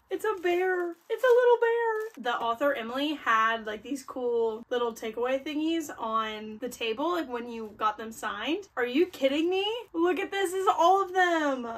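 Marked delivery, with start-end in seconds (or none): 0.1-2.2 rising into a high delighted squeal; excited squeal. Real, not acted. Vlogger opening gift from fan, high delighted squeal. 8.7-11.6 higher, thrilled disbelief; can't believe the haul; excited. Real, not acted. Vlogger shows fan's gifts, thrilled higher voice.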